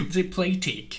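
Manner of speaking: put-on Kiwi accent with squeezed vowels. Fan amused by how the series cast talks, imitated Kiwi accent. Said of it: "I even like their Kiwi accent".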